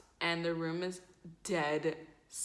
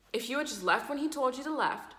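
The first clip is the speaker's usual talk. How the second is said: mildly acted higher-pitched scolding; scolding classmate; 6 semitones higher, 3 dB louder than usual. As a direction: tell someone off, pitched up.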